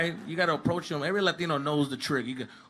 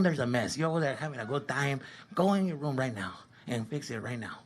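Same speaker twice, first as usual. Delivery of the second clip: low, soft mock-stern order; lightly voiced strict dad, keep it low; stern parent; 4 semitones lower, 7 dB quieter than usual. Parent sending the kid to his room, low mock sternness.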